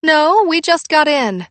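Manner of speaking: loud, high-pitched answer; calling back. A narrator's reading. Mom answering her daughter upstairs, calling back. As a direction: call the reply up to another floor.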